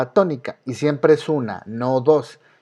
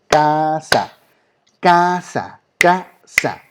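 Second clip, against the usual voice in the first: "casa" as CA... SA, drawn out in beats; syllable by syllable; 3 semitones higher, 4 dB louder, much slower than usual. Shown as a demonstration. Teacher recalls clapping out words in primary school, syllable by syllable. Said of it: "cuando los ponían a palmear en la primaria".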